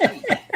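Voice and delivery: real high-pitched laugh. Real, not acted. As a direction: laugh out loud in amusement. Amused by the film idea.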